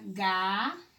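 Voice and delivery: "galho" as long rising gaaa, pause, lho; split into syllables. Shown as a demonstration. Teacher shows LH at the end, syllable by syllable. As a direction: break "galho" slowly into ga and lho. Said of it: "E na palavra".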